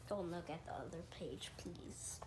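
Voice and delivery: hushed, fast aside; whisper. Real, not acted. Reading kid whispers a warning to listeners. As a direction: whisper it so nobody hears.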